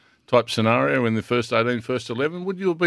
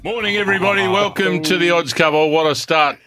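high, loud, bubbly radio welcome; excited; 9 semitones higher, 4 dB louder than usual. Real, not acted. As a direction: open the show with a burst of energy. Radio show opening.

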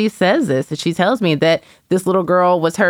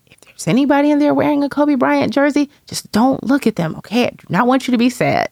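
higher, lightly voiced young daughter pleading; imitating daughter; 9 semitones higher than usual. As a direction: play the little girl gently warning Mommy, pitched up. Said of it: "she's like".

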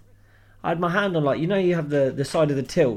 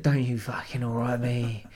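put-on drunk Clive; 3 semitones lower, 5 dB quieter than usual. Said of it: "he's had too many to drink".

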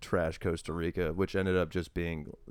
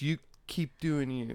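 imitating contestant; 5 semitones higher, 3 dB quieter, slower than usual. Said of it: "He goes".

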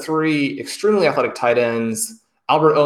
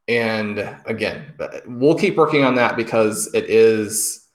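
ordinary talk, nothing marked

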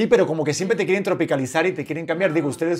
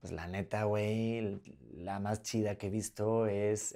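hesitant Mexican accent, much lower and softer; attempted Mexican accent; 6 semitones lower, 12 dB quieter, slower than usual. Shown as a demonstration. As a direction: try a low, slow, quiet Mexican accent. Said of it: "hice el acento mexicano".

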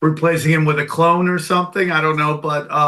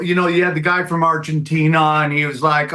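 ordinary delivery

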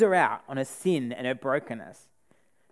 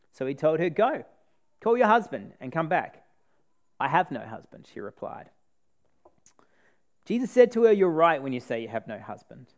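measured Bible reading voice; scripture reading; 3 semitones higher, 3 dB louder than usual. Acted. Preacher reading the Samaritan woman story to the congregation, measured and earnest. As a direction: read the passage with care and weight.

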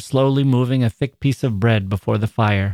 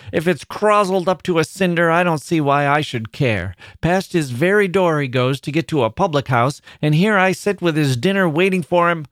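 higher-pitched indignant tirade; angry outburst; 6 semitones higher than usual. A narrator's reading. Wife railing at her absent husband over dinner, angry. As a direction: break out in bitter complaint about him. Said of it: "her anger was evident".

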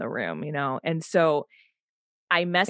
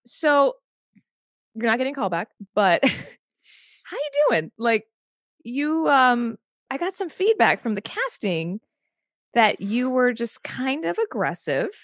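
higher, halting imitation of an agent; hesitant agent; 7 semitones higher than usual. Agent calls actor a day later with feedback, hesitant agent voice. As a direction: break bad news haltingly, pitched up. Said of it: "she's like".

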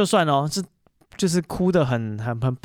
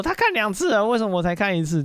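put-on much higher, wailing little-kid complaint; meltdown child; 7 semitones higher than usual. Little brother wailing to dad about his brother, high mock meltdown. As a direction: imitate a child falling apart over fairness. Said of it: "弟弟就又崩溃说".

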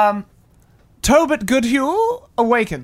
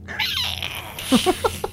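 evil cackle; 5 dB quieter than usual. Acted. Evil laugh.

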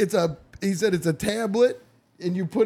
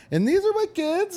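much higher, lightly voiced driver; mock driver; 13 semitones higher than usual. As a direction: proudly chirp it in a high put-on voice. Imitating driver showing kids.

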